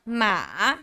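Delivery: MA dipping, then rising; third tone. Shown as a demonstration. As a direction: take "ma" down and back up. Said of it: "Third tone".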